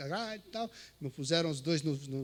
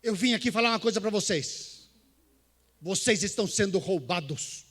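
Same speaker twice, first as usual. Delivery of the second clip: loud, firm declaration to the inmates; preaching declaration; 4 semitones higher, 8 dB louder than usual. Retold or imitated. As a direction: announce it straight at the prisoners. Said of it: "falei assim".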